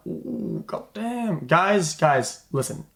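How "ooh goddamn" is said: hushed, drawn-out mock awe; mock amazement. Streamer playing someone hearing people's new opinion, mock amazed. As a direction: act quietly floored, pitch lifting.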